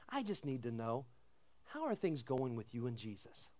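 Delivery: soft, low, caring ask; hushed pastoral inquiry; gentle question. Shown as a demonstration. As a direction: ask it softly and kindly.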